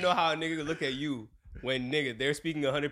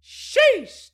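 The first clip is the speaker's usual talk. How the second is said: sheesh, shot up high and loud; excited exclamation; 12 dB louder than usual. Real, not acted. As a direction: blurt it out in disbelief. Reacting to heat and workload.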